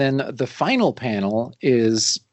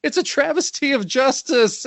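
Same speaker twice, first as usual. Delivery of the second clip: high-pitched petulant protest; hammed-up indignant whine; whining; 9 semitones higher than usual. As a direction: freak out like a thwarted villain, whining. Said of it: "he's just whining".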